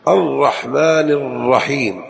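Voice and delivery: الرحمن الرحيم slow, each ر doubled; measured geminated ر in الرحمن. Shown as a demonstration. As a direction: press both ر sounds in الرحمن الرحيم. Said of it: "الراء مشددة ومشددة".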